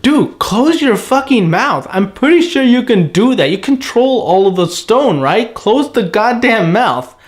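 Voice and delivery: high, slow exasperated scolding; exasperated rant. Retold or imitated. Reviewer scolding Pika's frozen face, high-pitched and exasperated. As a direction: tell him off like he's being ridiculous. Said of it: "it's like".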